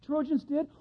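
ordinary talk

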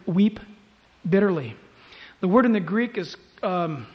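ordinary talk

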